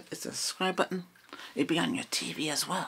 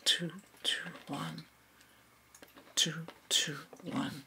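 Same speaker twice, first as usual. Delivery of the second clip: whispering; 3 semitones higher, 5 dB quieter than usual. Acted. ASMR roleplay whispering.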